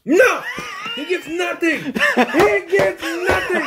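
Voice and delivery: high laughing mock outrage; laughing shout. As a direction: yell it while cracking up.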